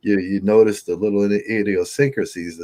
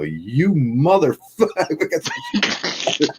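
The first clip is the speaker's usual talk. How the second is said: mock funeral yell; 10 semitones higher than usual. Friend yelling at his casket as a joke. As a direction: shout it through laughter. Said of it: "someone's gonna run up and go".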